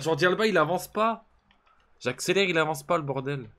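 plainte aiguë et forte; râle fort contre la manette; exaspéré. Real, not acted. Joueur qui n'avance pas. (énervé, voix qui monte)

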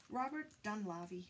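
ordinary talk, nothing marked

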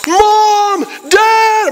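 high yelled Mom, Dad; calling out. Retold or imitated. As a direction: shout for your parents from the roof. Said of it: "I'm like".

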